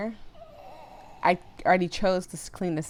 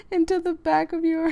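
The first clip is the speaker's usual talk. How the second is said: high and giddy; laughing while reading; 11 semitones higher, 5 dB louder than usual. Real, not acted. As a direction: read it through giggles. Reads through laughter.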